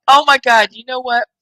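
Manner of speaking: ordinary talk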